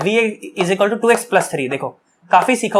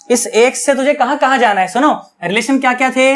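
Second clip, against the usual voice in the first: ordinary talk, nothing marked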